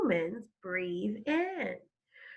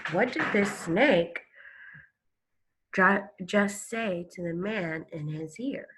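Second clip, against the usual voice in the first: ordinary talk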